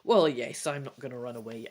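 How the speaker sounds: normal talking voice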